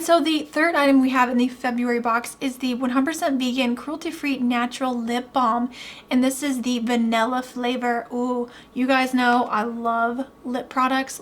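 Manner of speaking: ordinary talk, nothing marked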